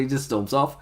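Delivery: ordinary talk, nothing marked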